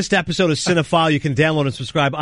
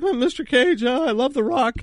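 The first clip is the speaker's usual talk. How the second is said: mock nervous fan; 8 semitones higher than usual. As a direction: act out a starstruck, timid greeting, pitched way up. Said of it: "So now I'm terrified".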